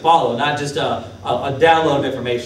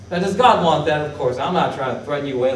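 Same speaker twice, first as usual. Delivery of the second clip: ordinary delivery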